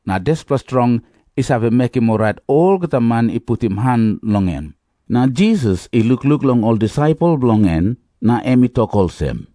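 plain reading voice

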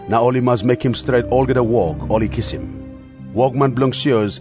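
ordinary talk, nothing marked

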